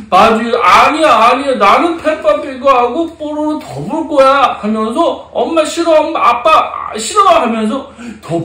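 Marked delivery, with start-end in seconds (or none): 0.6-4.6 higher-pitched mock tantrum; acted childish refusal, pitched up; defiant child. 5.3-7.2 high-pitched playful mock sulk; acted childish protest; defiant child. Acted child rejecting parents.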